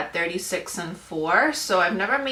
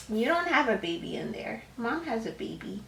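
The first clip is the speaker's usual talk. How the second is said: quietly teasing the little one; 9 dB quieter than usual. Real, not acted. Mom talking to her toddler.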